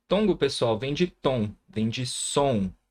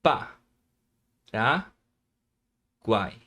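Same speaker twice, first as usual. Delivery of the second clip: "Pa, ra, guai" with long pauses between syllables; much slower than usual. Shown as a demonstration. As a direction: separate it firmly into "Pa", "ra", "guai". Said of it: "Vamos separar".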